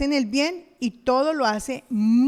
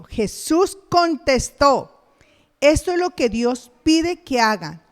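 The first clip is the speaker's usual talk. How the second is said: full-force preaching; 6 dB louder than usual. Acted. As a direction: drive the words home.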